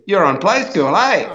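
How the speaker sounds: put-on a kid checking, thrilled; kid's voice. A boy in the river asking if he's the Play School host, thrilled. Local kid recognises him swimming.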